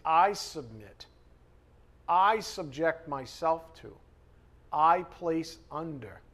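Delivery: each I leaned on, slow and deliberate; stress on I. Shown as a demonstration. Preacher stressing I to the congregation, slow and emphatic. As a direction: hit the word "I" hard in every phrase, slowly. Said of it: "with emphasis on I".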